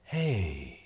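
soft low conspiratorial hey; hushed hey. Retold or imitated. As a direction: pull them in with a hushed hey. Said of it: "they're like".

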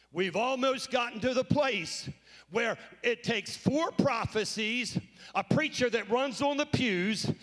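preaching. Acted. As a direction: preach it out to the congregation. Preacher building up.

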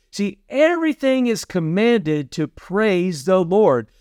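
ordinary talk, nothing marked

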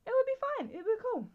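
high, breezy self-mockery; naive carefree voice. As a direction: act out your own naive confidence. Imitating her past carefree self.

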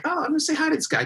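high, quick, eager imitation; friendly bass player. Bass player spotting the singer, imitated as eagerly friendly. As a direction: play a chirpy, guileless nice guy.

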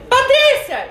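high, loud yell of "Patrícia!"; shout Patrícia. Shown as a demonstration. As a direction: cry "Patrícia!" as if winning the quiz. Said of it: "grita assim".

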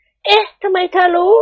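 high, slow puzzled lady's voice; the madam caught off guard; high-voiced mistress, puzzled; surprised lady. A narrator's reading. Thada surprised.

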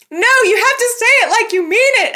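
insistent urging. Retold or imitated. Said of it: "And Nikki's like".

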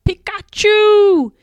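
high, loud, drawn-out creature cry; full-force cartoon creature yell; loud battle cry. A narrator's reading. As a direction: shout it out high, stretching the last syllable. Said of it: "With a loud".

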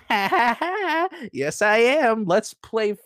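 high mock devil cackle, slow; devil laugh. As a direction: ham up a gleeful devil. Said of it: "And he's like".

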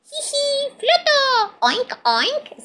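very high tiny voice with snorts; giggle, then oink with delight; high squeaky laughing little pig; giggling piglet. A narrator's reading. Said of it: "se ríe el George".

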